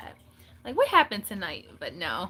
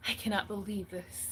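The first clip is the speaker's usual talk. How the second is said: muttered disbelief; 2 semitones lower, 8 dB quieter than usual. Retold or imitated. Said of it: "So when I'm mad, I'm like".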